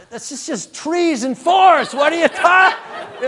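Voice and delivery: high, loud, slow cry of disbelief with a laugh; panicked yell. Retold or imitated. The lost driver yelling at his GPS among the trees, a loud panicked laugh-shout. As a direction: shout it at the machine in comic panic.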